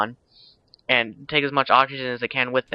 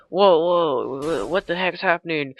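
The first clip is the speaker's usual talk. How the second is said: higher-pitched imitation of alarm; mock startled panic; 5 semitones higher, slower than usual. Retelling the man as rocks grow legs, mock startled panic. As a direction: act out a startled, stammering freak-out.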